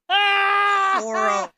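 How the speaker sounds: high, loud, drawn-out dismay; long high wail of dismay; appalled outburst. Real, not acted. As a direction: cry it out in amused horror, stretching it. Appalled, laughing at the villagers' nickname.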